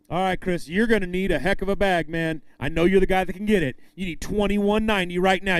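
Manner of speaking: ordinary talk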